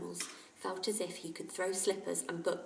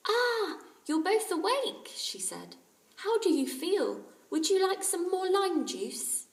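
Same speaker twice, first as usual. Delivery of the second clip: high, louder caring mother; motherly voice; 12 semitones higher, 7 dB louder than usual. Acted. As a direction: fuss over sick boys. Aunt Ally voiced.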